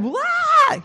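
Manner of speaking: high cartoonish cry of someone falling; imitated falling scream. Mimics a scream while falling.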